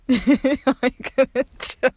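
high, laughing tease; laughing tease at brother; teasing laugh. Real, not acted.

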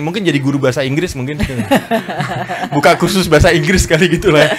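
chuckling through the joke about becoming a teacher; real laughter breaking into the words; laughing while talking. Real, not acted. Joking about becoming English teacher. (sambil tertawa lepas)

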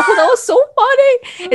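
high, laughing mock quote; mocking imitation. Retelling the brother's ban to friends, high-pitched mockery. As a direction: send it up in a high voice. Mimics someone about the banned account.

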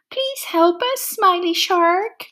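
high, pleading call for help; trapped fish begging for rescue; pleading cry. A narrator's reading. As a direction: beg the shark to save you. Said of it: "cried the fish".

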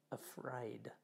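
hushed dramatic whisper. Shown as a demonstration. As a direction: let the word fall to a whisper. Whispered last word of gospel.

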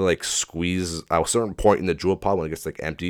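usual speaking voice

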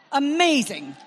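a ringing, declared Amazing; proclaimed. Acted. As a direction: lift Amazing out to the crowd. Repeated, lifted and loud.

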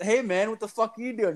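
high-pitched, baffled challenge; incredulous, pitched well up; retold bewilderment. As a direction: act out finding a guest where he shouldn't be. Retells confronting kid at breakfast.

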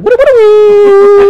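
high, loud, slow whistled western tune; mock western showdown whistle. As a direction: imitate the standoff music. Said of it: "that Western music".

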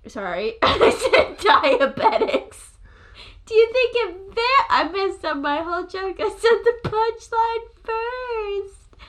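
breathless giggly talk; laughing through the words; after laughing hard. Real, not acted. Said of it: "let me collect myself".

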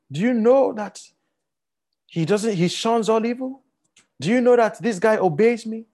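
a hint of God showing off his servant; proud, boasting God.

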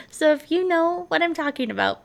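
ordinary talk

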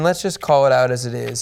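ordinary talk

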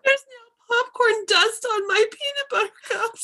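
high laughing complaint. Real, not acted. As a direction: wail it while laughing.

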